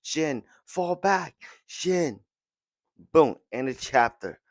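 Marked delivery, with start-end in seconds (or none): 0.0-2.2 drawn-out pleading shout, pitched up; high, slow calls. Retold or imitated. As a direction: call him back across the field. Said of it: "they're screaming to Shin".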